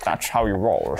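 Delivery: low, put-on Scottish voice; Sean Connery impression. Player echoing a friend in character, Scottish star impression. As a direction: echo it in a low Scottish burr. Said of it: "It's a very good accent".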